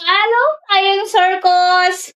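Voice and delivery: high, drawn-out hello; yelled greeting. Real, not acted. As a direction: call out the greeting, stretching the end. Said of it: "Why you gotta yell?".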